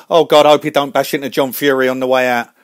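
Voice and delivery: ordinary talk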